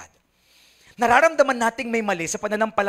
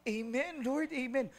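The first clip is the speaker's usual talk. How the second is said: soft, higher-pitched fervent amen; imitating devout seatmate; 6 semitones higher, 8 dB quieter, slower than usual. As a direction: murmur it prayerfully, in mock devotion. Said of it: "yung katabi mo, sabi". (mahina at mataas na taimtim na amen)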